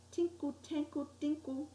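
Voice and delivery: high, soft little tinkle sounds; soft tinkling. A narrator's reading. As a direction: chime it softly like tiny bells. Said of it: "It was kind of soft at first".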